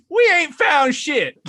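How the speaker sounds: stretched, giggling mock movie voice; Spaceballs desert guys. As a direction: ham up the Spaceballs line, slow and high through laughter.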